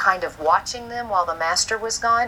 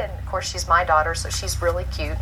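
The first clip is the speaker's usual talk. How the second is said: ordinary delivery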